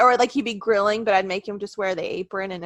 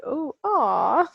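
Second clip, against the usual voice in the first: mock whimpering aw; 3 semitones higher than usual. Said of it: "It's like".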